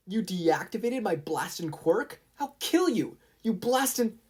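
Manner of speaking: high, loud rage; furious yell. Acted. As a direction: Katsuki raging at Momo, high and loud. Katsuki furious at opponent.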